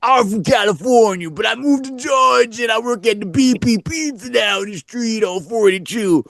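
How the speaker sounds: hammed-up pitchman. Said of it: "He's like".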